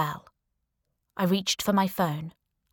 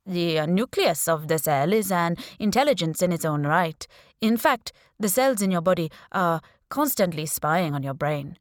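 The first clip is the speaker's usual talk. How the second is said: put-on Indian accent. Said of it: "he said in a strong Indian accent".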